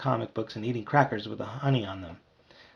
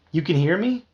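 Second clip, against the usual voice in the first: surprised; 5 semitones higher, 5 dB louder than usual. Acted. Shocked bee.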